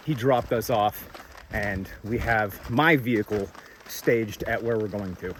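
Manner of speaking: ordinary talk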